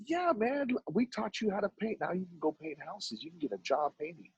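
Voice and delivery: high-pitched, eager reassurance easing down; acted-out pep talk, starting high and settling; encouraging mentor. Mentor telling a kid he can get work, encouraging.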